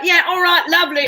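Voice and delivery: chirpy put-on British accent. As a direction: cheerfully agree in a fake British accent. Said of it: "talk with a British accent".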